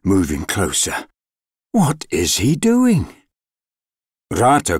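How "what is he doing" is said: high-pitched creatures' question; startled forest creatures, voices raised in pitch; astonished cry. A narrator's reading. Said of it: "cried the forest creatures".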